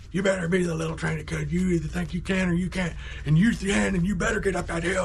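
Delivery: high-pitched mock tirade; hammed-up dressing-down ending in gibberish; imitating scolding dad. Dad chewing out his kid, mock angry lecture. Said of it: "just chews me up one side down the other".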